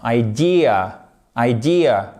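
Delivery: "Idea" as eye-DEE-uh, with a weak ending; English "Idea" with an attenuated last vowel; soft final schwa. Shown as a demonstration. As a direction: let "Idea" fall away on a little uh. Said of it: "vous avez un petit e atténué à la fin".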